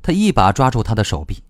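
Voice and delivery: ordinary delivery, nothing marked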